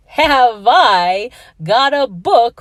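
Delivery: loud, slow, drawn-out triumphant cry; excited yell. Acted. Teacher shouting to her student, triumphantly loud and slow. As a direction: bellow it out like a happy announcement. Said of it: "Then she yelled".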